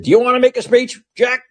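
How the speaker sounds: high-pitched sarcastic retort; mock-challenge, pitched well up. President retorting to the man who interrupted him, sarcastically. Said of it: "you know, sarcastically".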